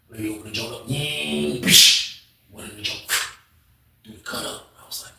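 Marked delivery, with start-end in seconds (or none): none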